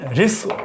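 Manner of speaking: रिस्व said as rissu; say rissv. Shown as a demonstration. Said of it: "ये ह्रस्व नहीं है, रिस्व है". (रिस्व को रिसु बोलें)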